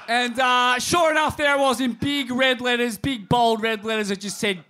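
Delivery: ordinary talk, nothing marked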